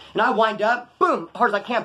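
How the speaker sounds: ordinary talk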